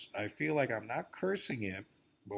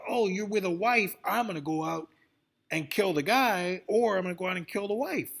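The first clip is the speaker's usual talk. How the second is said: higher and louder mocking voice; mock cheating girl; 6 semitones higher, 5 dB louder than usual. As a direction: ham up the scheming girl.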